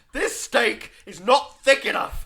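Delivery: loud, high, slow complaint; hammed-up furious customer; mock outraged diner. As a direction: boom it out like an indignant diner. Acted complaining diner in restaurant.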